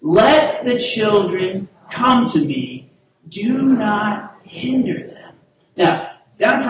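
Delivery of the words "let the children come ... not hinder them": slow, drawn-out call to recite together; leading a group recitation. Shown as a demonstration. Pastor leading the congregation in unison, slow and drawn out. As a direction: lead the room word by word. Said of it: "can we say that together".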